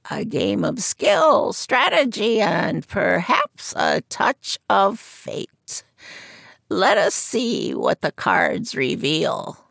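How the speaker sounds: put-on high croaky old seer's voice; croaking fortune teller. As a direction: croak it from behind a curtain. Madame Zora reading cards.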